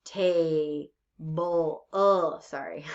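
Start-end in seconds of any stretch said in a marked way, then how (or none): 0.1-1.9 "table" drawn out as two separate beats; split into syllables. Shown as a demonstration. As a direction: say "table" slowly, ta, then ble. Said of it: "two syllables".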